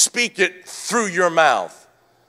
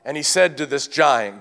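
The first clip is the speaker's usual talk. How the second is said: ordinary talk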